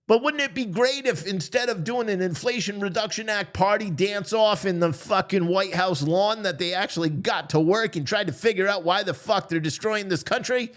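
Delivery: ordinary talk, nothing marked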